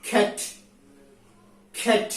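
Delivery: "cat" with a breath after the k, like খ; British aspirated k. Shown as a demonstration. Teacher showing British pronunciation to students, "cat" with k as খ. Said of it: "খর মতো উচ্চারণ করতে হয়".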